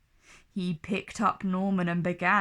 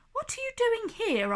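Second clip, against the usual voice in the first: put-on high boy voice; 12 semitones higher, faster than usual.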